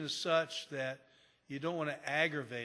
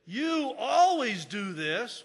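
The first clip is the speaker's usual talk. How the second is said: loud, slow, higher accusation; accusing spouse; 6 semitones higher, 10 dB louder, much slower than usual. Retold or imitated. Upset spouse blaming partner, loud slow accusation. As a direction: hurl the charge at them. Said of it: "If somebody says".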